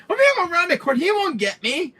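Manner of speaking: high, loud fleeing player; hammed-up panic on the run; acted panic. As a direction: blurt it out high, acting out a chase. Acts out fleeing from Jack.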